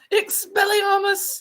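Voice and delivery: put-on slow, high spell casting in a British accent; mock British spell. Showing off accents, casting a British spell. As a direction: cast Expelliarmus in a British accent.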